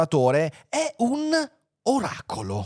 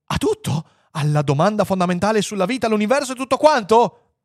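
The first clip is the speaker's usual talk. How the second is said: rising, louder excited question; eager questioning; 8 semitones higher, 5 dB louder than usual. Acted. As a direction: build higher to the last words. Men press the computer eagerly. (domanda incalzante ed eccitata)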